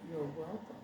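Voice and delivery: quiet snarky you're welcome under the breath; softly sneering you're welcome; sarcastic you're welcome. Retold or imitated. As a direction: mutter it with a sarcastic edge. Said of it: "I can be very snarky and sarcastic".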